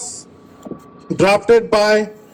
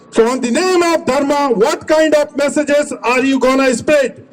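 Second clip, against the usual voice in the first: ordinary talk, nothing marked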